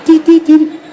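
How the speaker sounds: high, loud electronic beeps; communicator beeping. Retold or imitated. Copying the show's communicator with a high loud beep. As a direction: chirp like the Power Ranger communicator. Power Ranger communicator noise.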